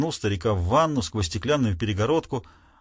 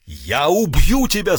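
louder, slow, bellowing threat; furious yell; 6 dB louder, slower than usual. Acted. Enraged father to Hugh, a furious yell. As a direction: bellow the threat as you charge. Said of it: "завопил разъярённый отец".

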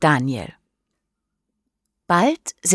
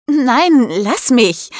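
high, raised-voice playful protest; calling out; 8 semitones higher, 4 dB louder, slower than usual. A narrator's reading. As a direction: cry out high, then ease down. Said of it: "ruft Mischa". (hoch gerufener Protest)

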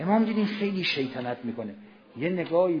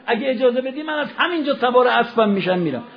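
fervent plea; 8 semitones higher, 8 dB louder than usual. Acted. Hurr pleads for martyrdom.

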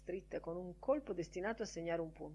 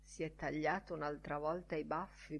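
plain reading voice

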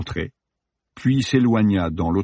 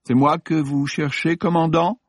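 sly man's voice; 9 semitones higher than usual. A narrator's reading. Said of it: "Une voix s'éleva".

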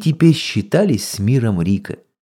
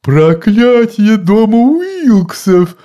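roaring; 11 semitones higher, 5 dB louder, slower than usual. A narrator's reading. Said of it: "ревела голова тёти Гортензии".

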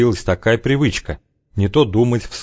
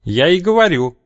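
snapping back; 8 semitones higher than usual. A narrator's reading. Shelomov snapping back at Zheka, mildly sharp. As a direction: snap back defensively. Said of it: "огрызнулся Шеломов".

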